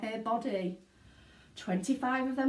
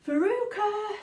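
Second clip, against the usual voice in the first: very high, loud call; a mother calling down the hole; shouting; 12 semitones higher, 5 dB louder, slower than usual. A narrator's reading. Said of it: "shouted Missus Salt".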